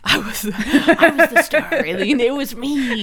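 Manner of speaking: laughing, grandly self-important; hammed-up diva. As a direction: play the star, laughing at yourself. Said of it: "So you were the star".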